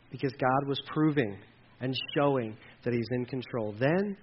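low, hushed, unhurried assurance; soft closing preaching. Acted. Pastor closing the sermon to the church, hushed and assuring. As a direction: settle them softly into trust.

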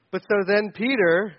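normal talking voice

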